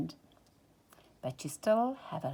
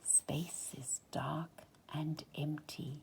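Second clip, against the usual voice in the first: slow, much softer whisper; 3 semitones lower, 11 dB quieter than usual. A narrator's reading. Narrator hushing the listener about dark empty space. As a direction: breathe the words into the dark.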